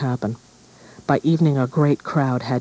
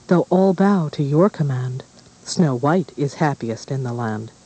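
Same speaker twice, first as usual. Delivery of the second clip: plain reading voice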